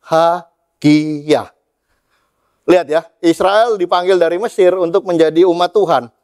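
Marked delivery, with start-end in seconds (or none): 0.0-1.5 drawn out syllable by syllable; slow, deliberate syllables; slow emphatic delivery. Acted. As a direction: stretch the word out, each syllable separate. Stretches key word of verse.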